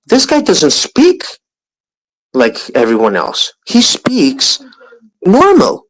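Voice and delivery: high emphatic stress on speak and normal; stretched high insistence. Real, not acted. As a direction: lean hard on the point. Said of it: "like a normal human being".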